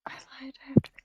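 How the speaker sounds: unvoiced whisper. Real, not acted. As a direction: hush it under your breath. Aside whisper at the table.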